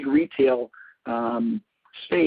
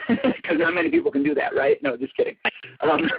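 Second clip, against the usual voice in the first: high, breathy laughter through the words; laughing at her own teasing joke; 11 semitones higher than usual. Real, not acted. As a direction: giggle through the teasing line. Teasing joke about being set straight.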